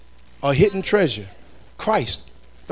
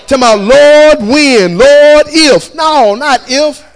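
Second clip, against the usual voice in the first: raised, fervent pulpit voice; full-force preaching; 7 semitones higher, 8 dB louder than usual. Real, not acted. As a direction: preach it out to the congregation, high and strong.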